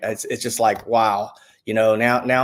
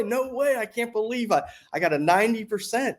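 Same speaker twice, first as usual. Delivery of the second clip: disbelieving joy; 10 semitones higher than usual. Retold or imitated. Retold joy at a rating.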